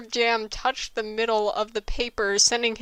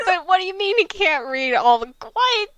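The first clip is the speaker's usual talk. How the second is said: incredulous protest; 10 semitones higher, 7 dB louder than usual. Real, not acted. Outraged at plot inconsistency.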